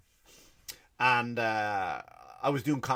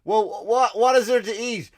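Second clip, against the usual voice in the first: put-on hungry child; 8 semitones higher, 6 dB louder than usual. Child asks, punchline answer.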